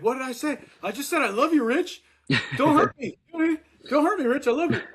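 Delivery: high-pitched, hammed-up begging; panicked mock pleading. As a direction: plead as if in big trouble. Retells pleading, fearing he's in trouble.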